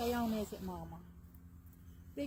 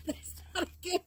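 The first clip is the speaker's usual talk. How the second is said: laughing through words; 6 semitones higher than usual. Real, not acted. Laughing at chicken's butt.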